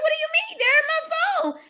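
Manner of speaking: squeaky high playful voice; high mock innocence. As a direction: play dumb in a very high voice. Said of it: "I'm like".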